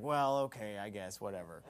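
low, quiet imitation of a giving-in dad; resigned dad. Dad giving in to his kid's argument, resigned mumble. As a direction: trail off softly in reluctant acceptance. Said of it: "my dad's like".

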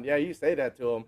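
ordinary talk

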